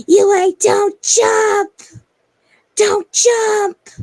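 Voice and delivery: very high, very loud drawn-out scream; mother's shout. Retold or imitated. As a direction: scream a slow, pleading warning. Mom calls out to jumper.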